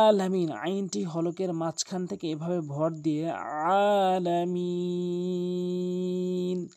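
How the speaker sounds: আলামিন slow, আইন pressed from mid-throat; correct Alamin. Shown as a demonstration. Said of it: "এভাবে পড়ব". (আলামিন ধীরে, হলকের মাঝখান থেকে)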